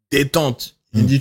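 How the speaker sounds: ordinary talk, nothing marked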